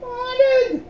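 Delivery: a high, timid squeak of fear; high nervous squeak of a question; small scared voice. Acted. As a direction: squeak the word out frightened. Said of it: "repeated in a small voice".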